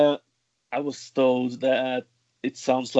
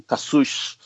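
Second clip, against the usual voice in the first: Cassous ending in a Rio "sh"; Rio accent. Shown as a demonstration. Guest showing the word in her accent from Rio, Rio accent. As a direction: say Cassous the Rio de Janeiro way, hushed final s. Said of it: "my accent from Rio".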